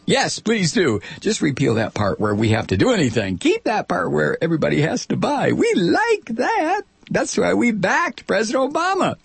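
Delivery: high shrill-free cackling witch, shouted. Acted. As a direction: play the insurer as a witch. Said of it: "it sounds like a witch".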